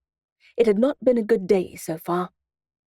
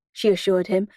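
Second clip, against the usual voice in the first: plain reading voice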